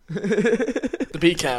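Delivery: slow British "The beer can."; British-accent pun on bacon. Shown as a demonstration. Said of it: "when you say beer can in a British accent".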